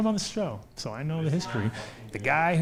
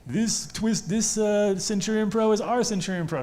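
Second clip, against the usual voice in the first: mock copycats; 7 semitones higher than usual. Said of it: "they were doing that, like".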